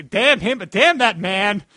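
mock film redub in Chicago accent; over-the-top Chicago accent. Fan redubbing The Patriot for laughs, Chicago accent. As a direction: punch it out in a hammed-up Chicago accent. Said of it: "redub of The Patriot with that accent".

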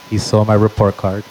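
usual speaking voice

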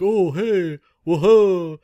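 happy grub dad. Retold or imitated. Guest voices the grub father greeting the player, jolly and high. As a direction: greet like a delighted portly dad. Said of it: "and you'll be like".

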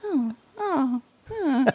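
mock-dejected little sighs; crestfallen. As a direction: act out bracing for bad news. Reenacts bracing for bad news.

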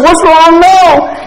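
high, loud, drawn-out question; utterly exasperated pastor. Acted.

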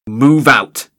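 loud commanding shout; shouted order. Acted. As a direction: bark the order to the troops. Guard barking an order.

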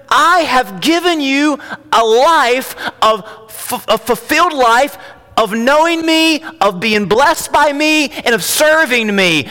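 high, loud, slow-hammered pulpit delivery; impassioned preacher speaking as the Lord; preaching at full force. Acted. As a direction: drive each phrase home with long stresses. Preaches as Jesus to congregation.

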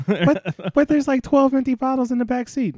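high, loud teasing wife voice; high mock wife voice. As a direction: squeal it like the wife. Wife voice continues.

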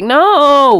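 loud, high-pitched acted no; exasperated mock rebuff; emphatic refusal. Woman turning down someone hollering at her, emphatic refusal. Rebuffing a man's advance retold.

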